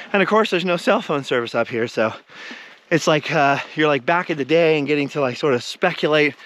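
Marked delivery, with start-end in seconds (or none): none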